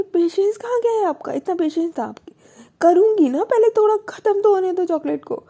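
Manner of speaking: high, loud exasperated telling-off; scolding. Acted. Wife scolding her partner for impatience, loud high exasperation. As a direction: scold her like she's lost all patience.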